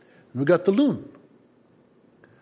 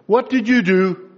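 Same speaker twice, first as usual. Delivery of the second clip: slower and louder, a firm insisting mom; pressing mother; 3 semitones higher, 5 dB louder than usual. Retold or imitated. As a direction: demand the truth this time. Mom presses the child again.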